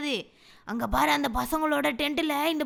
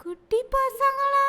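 high, slow eerie call; spooky ghost voice; 11 semitones higher, much slower than usual. Acted. Ghostly figure calls the little kids, slow high eerie voice. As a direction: drag it out like a haunting ghost.

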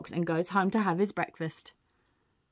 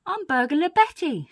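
high, loud lady-burglar voice; Burglar Betty; 9 semitones higher, 5 dB louder than usual. Acted. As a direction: announce yourself, pitched well up. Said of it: "says the lady".

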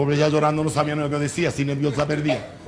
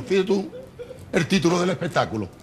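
ordinary talk

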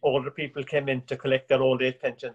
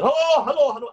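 much higher, louder shouted hello; bellowing; 11 semitones higher, 7 dB louder than usual. Retold or imitated. Danny bellowing into the phone, much higher and louder. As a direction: roar it into the phone. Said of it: "Danny was bellowing on".

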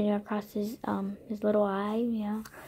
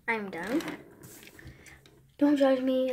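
ordinary talk

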